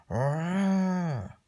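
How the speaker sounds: put-on high, drawn-out child's tantrum scream; play scream. Kid defying Dad in line, screaming. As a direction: let out a long mock yell. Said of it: "don't scream".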